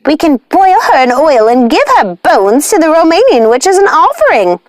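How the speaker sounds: high, shrill-free witch outcry, slowed on the cat; high furious witch voice; raging witch sister. Acted. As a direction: demand vengeance like a furious hag.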